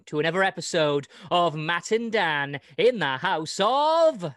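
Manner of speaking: loud, grand welcome to the show; grand entrance announcement. Acted. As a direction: welcome them in like a showman. Host's grand show opening.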